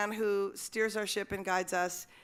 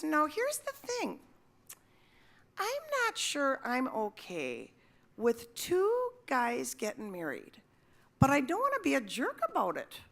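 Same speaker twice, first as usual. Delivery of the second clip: hesitant confession; 4 semitones higher than usual. Retold or imitated. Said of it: "And she goes".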